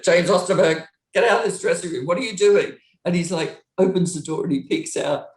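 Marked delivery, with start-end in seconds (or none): 0.0-2.7 louder mock-stern mum telling him off; scolding mum. Tour manager scolding [name] through the door, mock mum telling-off. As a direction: scold him out of the room like his mother. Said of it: "like I'm his mum".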